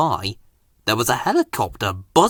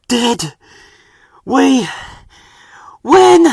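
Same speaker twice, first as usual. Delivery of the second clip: slow, gasping words, louder each time; breathless; 9 semitones higher, 7 dB louder, much slower than usual. A narrator's reading. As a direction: gasp it out after the race. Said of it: "he said breathlessly".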